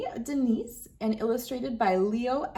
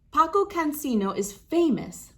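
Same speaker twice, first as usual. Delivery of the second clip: proud mother; 6 semitones higher, 3 dB louder than usual. Acted. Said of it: "Mommy tells her".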